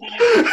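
sudden high, loud real laugh; burst of laughter. Real, not acted. Telling friends about the troll's apology, bursting into laughter. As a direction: crack up at the memory.

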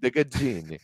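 high-pitched Italian-accented Gaggini; pretend Italian accent. Shown as a demonstration. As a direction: play up the Italian lilt on Gaggini. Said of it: "say it like I'm pretending".

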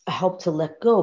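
usual speaking voice

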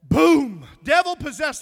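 ordinary delivery

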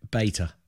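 "beta" as BAY-tuh; long-a pronunciation. Shown as a demonstration. Co-host answering how his side says it, "beta" as BAY-tuh. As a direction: say "beta" with an ay vowel. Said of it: "how do you guys say it".

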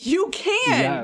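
high, laughing, excited approval; gleeful yes. Real, not acted. Game master grants a player's silly request, gleeful. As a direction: say it high and delighted, half laughing.

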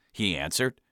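usual speaking voice

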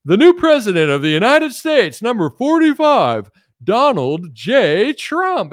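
mock announcer declaring the new president. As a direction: boom it out like a TV result call. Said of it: "they're like".

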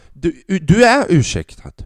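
loud, slow, mock-gracious; sarcastic reply. Comic to old lady in the lift, loud slow sarcasm. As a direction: grant the pardon with heavy sarcasm.

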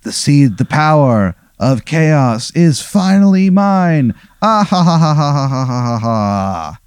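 a hammed-up villain cackle over the seeds; villain gloat. The Onion King gloating over the seeds, hammed-up evil laugh. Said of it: "and he goes".

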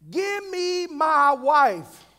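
slow preacher's cry of a man demanding his due; insistent demand. Acted. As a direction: stretch each word as an overdue claim. Preacher acts out Jacob's demand.

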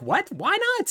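squeaky high disbelief; shocked. Retold or imitated. Podcaster voicing Vito stunned by Leo's refusal, squeaky high. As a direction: jump way up in shock.

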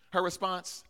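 ordinary talk

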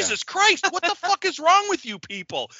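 very high-pitched acted exasperation; startled outburst. [name] to people who freaked out, high-pitched mock alarm.

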